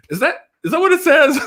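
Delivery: high, disbelieving question; can't believe what he's reading; incredulous. Retold or imitated. Dazed patient asking his buddy Joe about the form, incredulous.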